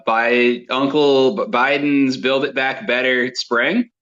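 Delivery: normal talking voice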